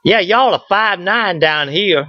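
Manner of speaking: slow, drawn-out Southern accent; Southern drawl. Shown as a demonstration. Georgia native translating a radio report into a slow Southern accent. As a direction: stretch the vowels in a thick Georgia Southern accent. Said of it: "I'm bilingual, Southern and English, so I can translate".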